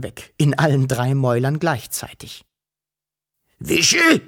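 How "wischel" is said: high, urgent dragon call; alarmed calling after someone vanished; hissed shout. A narrator's reading. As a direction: call out as loud as possible. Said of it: "fauchte er".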